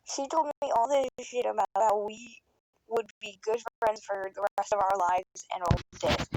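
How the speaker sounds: normal talking voice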